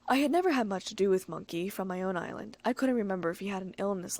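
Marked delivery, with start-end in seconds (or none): none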